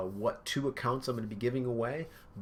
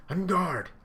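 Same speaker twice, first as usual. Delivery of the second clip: loud, higher battle cry; shouted challenge; 8 semitones higher, 6 dB louder than usual. Retold or imitated. Sanji squaring off against Luffy, loud battle cry. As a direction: bark the challenge at him.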